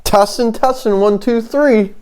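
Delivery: high-pitched mock mic test; supposed real voice. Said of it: "that's what my real voice sounds like".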